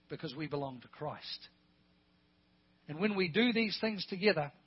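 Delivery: usual speaking voice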